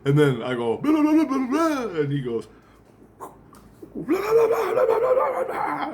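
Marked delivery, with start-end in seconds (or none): 0.8-2.0 loud, high-pitched blah-blah retort; squealed mock comeback; mock arguing. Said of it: "giving each other a pissing match". 3.2-5.9 very high squealed gibberish; loud, squeaky mock quarrel; mock arguing. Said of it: "giving each other a pissing match".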